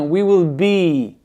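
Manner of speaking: "be" stretched out slowly; long I. Shown as a demonstration. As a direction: drag the I in "be". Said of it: "Ça traîne".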